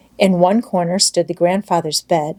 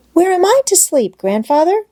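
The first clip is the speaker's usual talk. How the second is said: put-on higher, bright child's voice full of longing; eager little girl Heidi; 11 semitones higher, 4 dB louder than usual. A narrator's reading. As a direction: play the excited little girl. Heidi asks about her bed.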